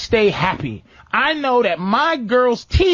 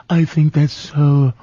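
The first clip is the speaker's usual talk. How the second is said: lower, slowed, put-on voice as if it were someone else's; a lightly hammed-up impression of a buddy; mock Jeff voice; 8 semitones lower, slower than usual. Host mimics cohost Jeff, mocking, lower and slowed.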